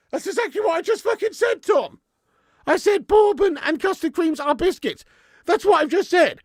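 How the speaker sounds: high, loud indignant outburst; exasperated. Real, not acted. Streamer telling off a chatter who repeated him, exasperated. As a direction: snap back at being told what was just said. Exasperated rant at viewer Tom.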